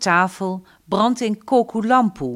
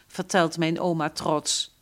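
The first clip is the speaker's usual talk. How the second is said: usual speaking voice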